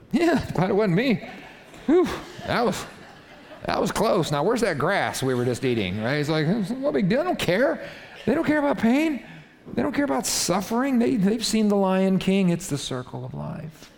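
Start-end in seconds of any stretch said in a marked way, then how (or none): 0.1-6.0 high-pitched mock relief; carefree animal. Grazing animals after a lion's kill, comic relief. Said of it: "what the other animals are saying". 6.5-13.9 high, comic shrugging-it-off voice; unbothered animal. Acted. Animals who don't care about suffering, comic nonchalance. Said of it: "what the other animals are saying".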